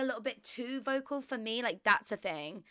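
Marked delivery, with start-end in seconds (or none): none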